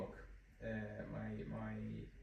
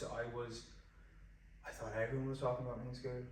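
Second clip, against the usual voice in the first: ordinary delivery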